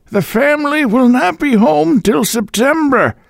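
put-on high, thin old landlady voice; a deaf old woman fobbing him off, high-pitched. A narrator's reading. Landlady brushing off the visitor at the door, high old-woman voice.

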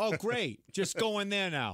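lightly acted exasperated movie heckler; mock exasperation. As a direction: imitate griping at a movie screen. Mocks talking back at movie.

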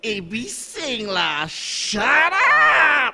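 tearful monster yell; ghost wail. Acted. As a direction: wail it like an angry spirit. Ghost wails angrily.

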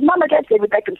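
a hint of an excited little boy; imitated young son. Son telling his mother who he sees, lightly imitated child voice. Repeats her young son's exclamation.

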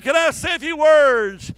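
high, loud, stretched-out quote; emphatic retelling. Retold or imitated. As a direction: preach her question out loudly. Repeats woman's words at full force.

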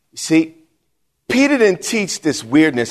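normal talking voice

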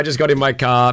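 ordinary talk, nothing marked

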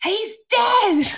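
very high, mock-scream; high-pitched screamed announcement, acted out; re-enacted scream. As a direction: squeal it out in mock outrage. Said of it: "I just screamed that at her".

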